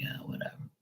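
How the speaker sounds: unvoiced whisper, dismissive; whispered brush-off. Brushing off mom's advice, in a whisper. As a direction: breathe it out like a bored teen. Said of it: "I'm like".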